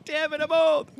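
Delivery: mock lament. Mock lament about age.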